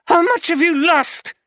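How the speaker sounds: wife repeating demand, now shouting; shouted demand. Acted. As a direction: scream the question at him.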